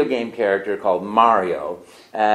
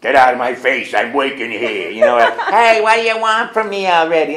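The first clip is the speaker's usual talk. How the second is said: tough Brooklyn Italian voice, louder; 7 dB louder than usual. Retold or imitated. Actor trying a rough Brooklyn plumber voice. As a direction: bark it like a gruff Brooklyn plumber. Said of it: "Italian plumber from Brooklyn".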